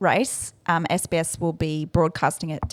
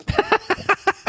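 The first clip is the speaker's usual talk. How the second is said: high laugh; 4 dB louder than usual. Real, not acted. Laughing at mispronounced Shreds.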